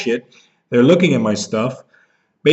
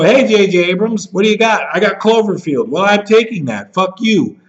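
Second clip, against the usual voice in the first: high, louder mock exchange with J J Abrams; mocking dialogue; 6 semitones higher, 3 dB louder than usual. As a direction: act out both sides, pitch up and louder. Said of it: "don't just go".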